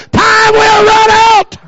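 building to a high, loud, slow crying shout; fiery preacher's crying climax; preaching shout. Real, not acted. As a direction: hammer the warning home at full force. Sermon climax warning.

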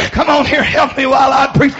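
ordinary delivery

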